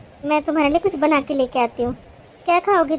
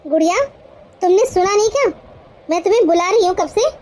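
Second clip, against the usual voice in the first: impatient, higher-pitched telling-off; scolding shout; 4 semitones higher than usual. Acted. Bhabhi calls Gudiya who hasn't answered, scolding impatiently. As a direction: demand to know why she hasn't answered. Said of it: "मुझ पर चिल्लाओ ना".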